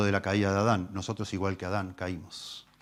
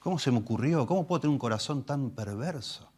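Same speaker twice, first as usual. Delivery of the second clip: remorseful sinner; 5 semitones higher than usual. Retold or imitated. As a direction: play a sinner horrified at himself. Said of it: "nunca dijo".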